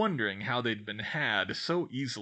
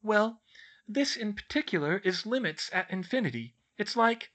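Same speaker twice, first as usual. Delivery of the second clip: put-on bookish girl explaining; 10 semitones higher than usual. A narrator's reading. Girl explains her maths book to friends, earnest. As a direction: explain in a high, earnest schoolgirl voice. Voices Hermione in story.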